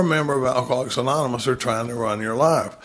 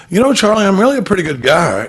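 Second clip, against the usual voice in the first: defensive protest; 5 semitones higher, 7 dB louder than usual. Retold or imitated. Sponsee protesting his goodness.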